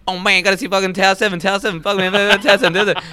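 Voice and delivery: high, loud, rapid-fire rant; Eddie Bravo impression. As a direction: rattle it off loud and high, obsessed. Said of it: "I don't know why I talk like this".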